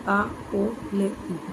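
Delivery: slow and soft, sounds of ट्रॉली split: आ ओ ले ई; spelled out slowly. Shown as a demonstration. Teacher spelling ट्रॉली for students, slow and soft. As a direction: sound out ट्रॉली piece by piece, softly. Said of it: "इसको हिंदी में लिखेंगे तो ऐसे".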